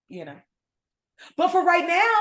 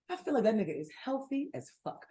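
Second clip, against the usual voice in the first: much softer restatement; hushed aside; 2 semitones lower, 9 dB quieter than usual. Real, not acted. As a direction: drop the volume and say it confidentially. Same words repeated, dropped down.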